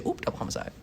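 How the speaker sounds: breathy unvoiced whisper of the quip; tossed off as a whisper. Whispering the callous TikTok quip to the crying woman. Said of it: "and you say".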